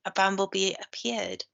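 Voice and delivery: ordinary delivery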